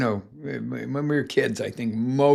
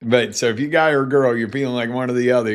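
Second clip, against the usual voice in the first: ordinary delivery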